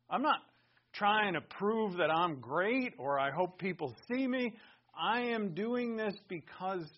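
high, loud avowal; earnest, forceful protest; emphatic declaration. Acted. Acts a believer's emphatic answer.

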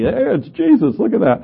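high-pitched imitation of an excited discoverer; hammed-up, high-voiced astonishment; mock amazed onlooker. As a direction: mimic someone marveling at a funny stain. Mimics people spotting Jesus in stain.

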